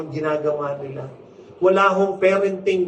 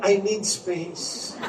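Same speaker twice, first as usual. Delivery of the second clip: drawn-out, softer mock teen line; slow mock teen; 4 dB quieter, much slower than usual. Mother quoting her son's constant line, slow and soft. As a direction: stretch it like a sulky teenager. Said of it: "Palagi niyang sinasabi".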